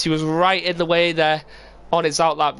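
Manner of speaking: ordinary talk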